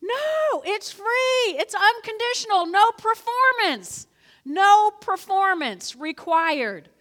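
high, loud, slow insistence; emphatic retort. Retold or imitated. Believer answering a doubter, high, loud and slow. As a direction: insist firmly, slowing on each phrase. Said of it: "you say".

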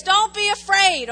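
loud command from Jesus; firm command. Acted. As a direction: say it as an order. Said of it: "He says".

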